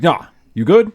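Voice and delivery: hamming up a tough guy backing down; mock bro. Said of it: "he's just like".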